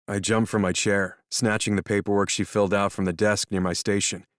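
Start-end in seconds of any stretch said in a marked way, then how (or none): none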